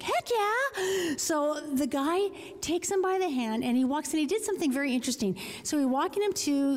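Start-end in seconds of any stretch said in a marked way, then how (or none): 0.0-1.2 high, eager burst; high excited heck yeah; eager yes. Retold or imitated. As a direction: squeal it like a thrilled kid. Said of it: "and I'm like".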